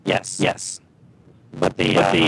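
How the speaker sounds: distorted warbling voice, like a bad connection; garbled alien-sounding transmission; gurgling alien voice. Real, not acted. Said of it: "'Cause you're getting the alien voice".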